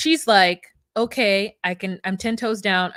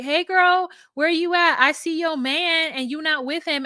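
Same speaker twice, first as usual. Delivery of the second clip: high, sing-out tattling friend; acted-out nosy friend; 8 semitones higher than usual. Friend tells her she saw her man, high mock call-out.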